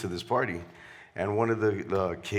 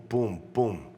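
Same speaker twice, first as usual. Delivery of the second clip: ordinary talk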